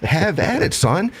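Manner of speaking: high-pitched, goading taunt; mock challenge. Acts taunt in a quarrel.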